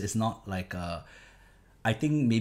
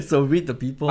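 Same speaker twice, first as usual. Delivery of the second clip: laughing; 5 semitones higher, 7 dB louder than usual. Real, not acted. Laughing on title.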